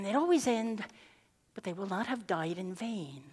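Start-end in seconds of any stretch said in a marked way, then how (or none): 1.5-3.2 romantic ending. Retold or imitated. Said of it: "like the movie ... romantic end".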